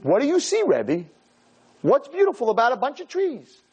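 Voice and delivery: high mock-puzzled kid voice; kids asking. Kids on a trip question their rabbi, high and puzzled. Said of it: "I had kids on a trip that went with me and said".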